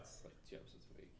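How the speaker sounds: unvoiced whispered aside; whispering. Real, not acted. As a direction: whisper it under your breath. Muttered aside under breath.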